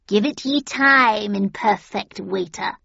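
crying witch. A narrator's reading. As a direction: cry it out like an old witch. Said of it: "she cried".

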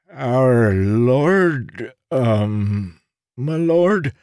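put-on high, puzzled old beggar; confused beggar. A narrator's reading. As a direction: puzzle over the words.

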